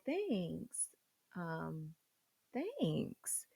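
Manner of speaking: a tiny breathless whisper of thanks. Real, not acted. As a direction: barely voice it, hoping not to be noticed. Nervous thanks hiding her smell.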